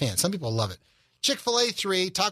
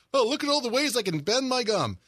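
imitating daughter; 7 semitones higher than usual. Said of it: "My daughter does that. It's like".

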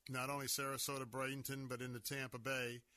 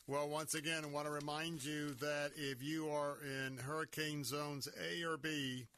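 normal talking voice

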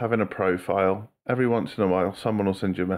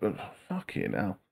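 usual speaking voice

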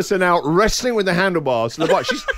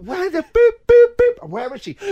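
mock beeping search; 5 dB louder than usual. Dad mimics tracker while searching.

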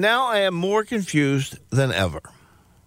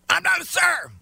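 high mocking clerk voice; 14 semitones higher than usual. Clerk correcting the customer, high and indignant. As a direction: squeak it out like the offended clerk. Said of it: "Thank you, sir".

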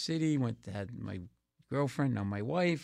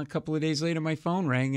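ordinary delivery

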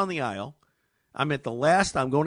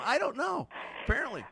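ordinary talk, nothing marked

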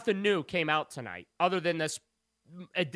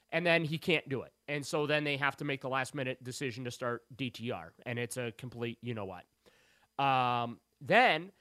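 ordinary talk

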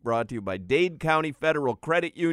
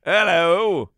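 mock British accent; 4 semitones higher, 8 dB louder than usual. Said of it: "that was good".

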